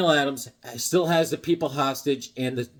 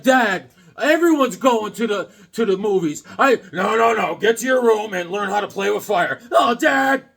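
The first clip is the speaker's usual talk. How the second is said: whiny son, then Satan dad shouting back; higher, louder kid pleading, then a loud fatherly bark; 5 semitones higher, 5 dB louder than usual. Retold or imitated. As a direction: kid begs, devil dad shouts him down. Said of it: "imagine your father being Satan".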